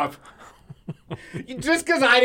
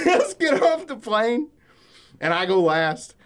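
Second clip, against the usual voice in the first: normal talking voice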